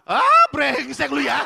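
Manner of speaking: loud, high, drawn-out mock fury; exasperated outburst. Diner cursing the staff who finally answered, loud exasperated outburst. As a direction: explode in comic indignation.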